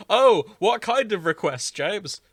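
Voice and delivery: high, loud mock-curious voice; mock eager question. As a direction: play the eager listener. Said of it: "I was expecting".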